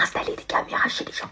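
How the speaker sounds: conspiratorial whisper aside. Acted. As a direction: hush it to the others. Whispered aside about colleague.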